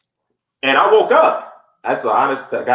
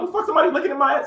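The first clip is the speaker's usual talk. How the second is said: shocked outburst; 13 semitones higher, much faster than usual. Retold or imitated. Retelling shock at waking.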